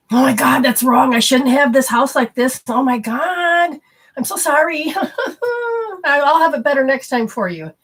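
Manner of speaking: high, loud, near-tears gushing apology; frantic over-apology. Retold or imitated. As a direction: ham up a panicked hostess begging forgiveness. Frantic over-apology for messy house.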